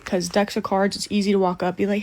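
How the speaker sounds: usual speaking voice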